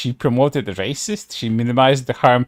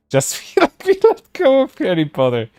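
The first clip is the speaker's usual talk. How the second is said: high, breaking into laughter mid-line; can't keep a straight face reading it; laughing through the words; 11 semitones higher than usual. Real, not acted. Reading a ridiculous quote to chat, laughing.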